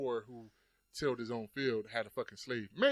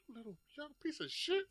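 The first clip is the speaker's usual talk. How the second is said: high, mock insulting jab; mock grumpy elder; 14 semitones higher, 5 dB quieter than usual. Older self mocking the young, playful insult. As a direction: scold the young ones in fun.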